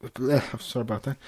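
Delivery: ordinary delivery, nothing marked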